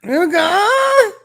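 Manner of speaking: high, loud mocking squeal of her words; mock incredulous echo. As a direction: echo it back in a shrill parody. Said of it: "Look what she said".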